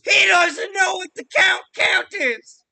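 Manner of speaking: slow, loud incredulous shout; outraged disbelief. Real, not acted. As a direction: broadcast the outrage, slowly. Mock outrage to audience.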